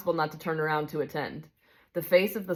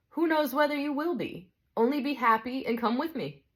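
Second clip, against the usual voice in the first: a touch higher, calm and kindly; gentle bright spirit; 8 semitones higher than usual. Acted. Bright spirit calmly answers the big ghost, kindly.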